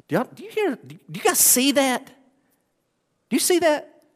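stunned delight, pitched high and stretched out; amazed. Real, not acted. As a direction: lean in and marvel, slowing right down. Preacher marvels at the verse.

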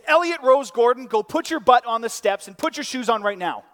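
loud, high full-name scolding; parent yelling. Acted. Dad at the end of his patience with his daughter, yelling. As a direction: yell the order at a child who won't listen. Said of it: "And then it ends like this".

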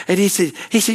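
ordinary talk

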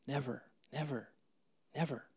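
quiet, fading "never, never, never"; hushed insistent repetition; soft repeated never. Real, not acted. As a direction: let each "never" fade. Pastor softly repeating never.

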